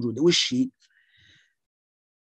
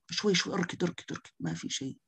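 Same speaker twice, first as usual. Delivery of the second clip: much softer, gently coaxing calm; soft soothing; 3 semitones higher, 10 dB quieter than usual. Shown as a demonstration. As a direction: settle them down quietly. Soothing an anxious patient.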